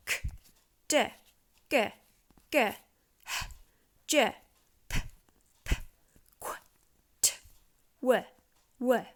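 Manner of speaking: pure phonics sounds, each clipped short. Shown as a demonstration. Teacher models the sound chart for parents. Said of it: "So then we'd go".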